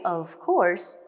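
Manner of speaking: plain reading voice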